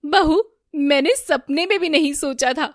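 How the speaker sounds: loud, high indignant scolding; outraged reproach. A narrator's reading. Mother-in-law berates the caught daughter-in-law, outraged reproach. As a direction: reproach loudly, voice pitched high.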